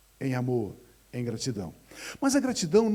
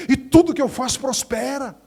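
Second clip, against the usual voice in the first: much louder and higher, triumphant; triumphant declaration; 8 semitones higher, 9 dB louder than usual. Retold or imitated. Believer confessing faith to the pastor, loud and triumphant. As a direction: proclaim it with full force. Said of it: "começa a praticar a gratidão".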